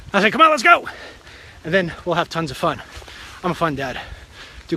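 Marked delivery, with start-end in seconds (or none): 0.3-0.9 eager call to go. Retold or imitated. Dad rallying the family.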